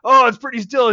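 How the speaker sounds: lightly cartoonish high-pitched guy; mock excited friend. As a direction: ham up a giddy teen boy's discovery. Friend quoted in retold movie scene.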